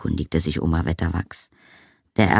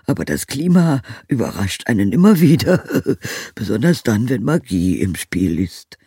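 chuckling; 6 semitones higher, 8 dB louder than usual. Acted. Wizard jokes about magic weather.

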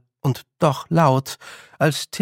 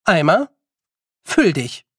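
firm, raised order to an object; mildly imperious spell-like command; 4 semitones higher, 5 dB louder than usual. A narrator's reading. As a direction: order it crisply, word by word. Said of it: "befahl sie".

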